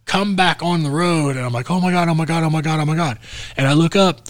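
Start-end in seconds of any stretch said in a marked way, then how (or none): 1.7-3.2 panicked. Retold or imitated. After swerving past the deer.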